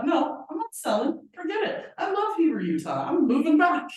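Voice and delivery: high, loud, animated mock refusal; defiant refusal. Imagined brother telling the broker he won't sell, defiant.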